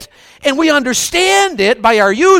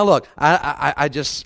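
normal talking voice

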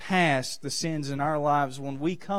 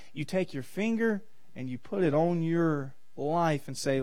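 normal talking voice

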